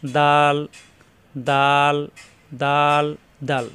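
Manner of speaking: দাল with tongue tip at upper tooth roots; drawn-out, clearly dental দাল. Shown as a demonstration. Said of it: "জিহ্বার আগা উপরে দুই দাঁতের গোড়ায় লাগিয়ে".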